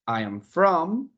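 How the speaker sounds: slow, drawn-out model phrase for learners; slow model answer. Shown as a demonstration. Teacher modelling an English answer for Spanish-speaking students, slowly. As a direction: lay each word out slowly for the class. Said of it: "Where are you from?".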